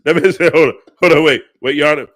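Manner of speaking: loud, high comic courtroom protest; flustered mock plea. Host clowning to Your Honor about what that man said, flustered mock plea. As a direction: blurt it loudly over the interruptions.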